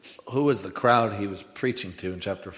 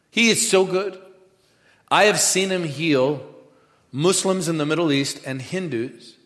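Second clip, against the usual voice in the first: slow, emphatic, higher-pitched testimony; fervent preaching; 5 semitones higher, 5 dB louder, slower than usual. Real, not acted. Preacher testifying to congregation about healing, fervent and deliberate. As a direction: preach it with awe, phrase by phrase.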